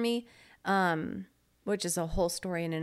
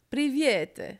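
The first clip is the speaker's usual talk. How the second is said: high, loud mock-Italian attempt at Russian; mock Italian accent; 7 semitones higher, 5 dB louder than usual. Teasing the co-host's pronunciation, exaggerated Italian accent. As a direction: imitate an Italian trying to speak Russian. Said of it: "an Italian trying to speak Russian".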